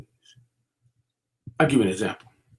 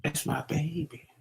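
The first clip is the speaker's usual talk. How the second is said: soft whispered coo of a doting mother; doting mama whisper; 15 dB quieter than usual. Retold or imitated. Mama softly whispering about her jailed son. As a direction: whisper it like a mama cooing over her baby. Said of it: "Mama's gonna say".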